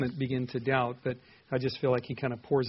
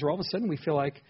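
ordinary talk

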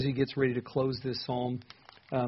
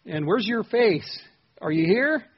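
high, loud, pleading cry of doubt; anguished doubt; 8 semitones higher, 7 dB louder than usual. Pretend. Believer crying out to an absent God, high and loud. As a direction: cry out to a God who seems gone. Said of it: "all of a sudden you feel like".

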